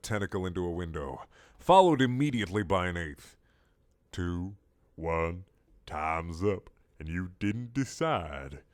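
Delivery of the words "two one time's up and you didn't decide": sly squid ultimatum; low countdown. Acted.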